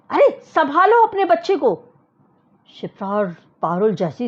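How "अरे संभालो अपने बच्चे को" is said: loud, high call from the line; shouted warning. Acted. People in the queue shouting at the family. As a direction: yell at the parents to mind their child. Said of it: "वो जोर से चिल्लाए".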